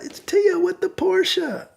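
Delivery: put-on very high, drawn-out drunk woman; drunk caller. Drunk woman announcing herself by phone, high mock whine. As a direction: imitate a drunk woman dragging out her words. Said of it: "she's really drunk".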